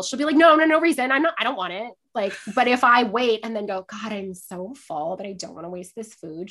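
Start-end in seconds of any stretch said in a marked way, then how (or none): 0.3-1.9 high, loud burst of protest; mock defensive denial. Friend caught staring at the meal, hastily defensive. 3.9-6.5 lightly put-on reluctance; feigned weary fullness. Diner offering leftovers to hungry friend, weary and staged.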